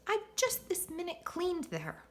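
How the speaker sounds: high-pitched, dismayed character voice; high storybook voice of dismay; Missus MacOtter. A narrator's reading. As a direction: complain in a high, put-out lady's voice. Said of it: "gasped Missus MacOtter".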